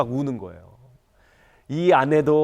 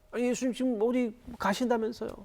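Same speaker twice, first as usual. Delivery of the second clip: high-pitched, softer questioning voice; higher, lightly voiced objection; puzzled disciple; 11 semitones higher, 4 dB quieter than usual. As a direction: play a disciple protesting to Jesus, pitched well up. Acts out disciples questioning Jesus.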